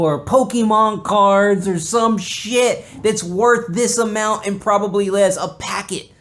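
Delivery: loud, high mock-outraged rant; kid's rant. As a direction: rant like a cheated child. Ranting as a kid about presents.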